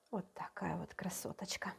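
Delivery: breathy admiring whisper. Real, not acted. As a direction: whisper it lovingly to the food.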